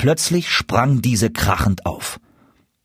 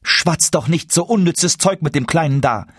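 higher-pitched, annoyed shopkeeper woman; displeased mother; 7 semitones higher than usual. A narrator's reading. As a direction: scold the customer impatiently. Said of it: "sagte endlich die Frau des Schusters in Unmut". (unmutige Schustersfrau, höhere Stimme)

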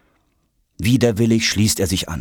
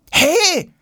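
high, loud indignant cry; protesting; 5 dB louder than usual. A narrator's reading. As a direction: shout in protest, pitched right up. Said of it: "protestiert Jonas". (lauter, hoher Protest)

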